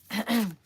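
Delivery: sharp throat clear. Real, not acted. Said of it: "Totally losing my voice here".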